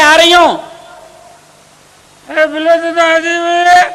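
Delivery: high, loud harried wife; busy wife shouting at her sick husband from the next room. Acted. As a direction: yell back from the kitchen, busy.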